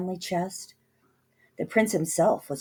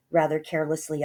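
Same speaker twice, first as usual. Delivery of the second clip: plain reading voice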